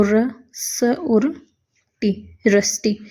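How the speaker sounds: र, स, टी spelled slowly, rusty whispered; slow spelling out. Shown as a demonstration. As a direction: sound out each part slowly. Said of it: "सट के बाद में ई की vowel". (धीरे-धीरे अक्षर)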